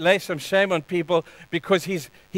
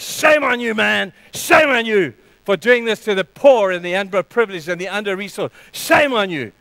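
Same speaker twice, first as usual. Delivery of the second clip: loud, outraged shout of shame; angry rebuke; 4 dB louder than usual. Retold or imitated. Jesus rebuking the temple con artists, outraged shout. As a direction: thunder it at the cheats in the temple. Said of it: "He says".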